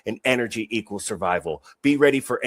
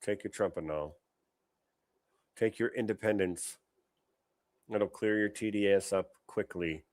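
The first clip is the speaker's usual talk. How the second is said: mock ad pitch; 8 dB quieter than usual. Host mocks the drug ad, softly murmured.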